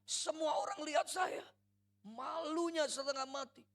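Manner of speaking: a daughter yelling out years of hurt; furious teenage daughter shouting at her father; angry outburst. Retold or imitated. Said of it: "kemarahan dia mulai muncul".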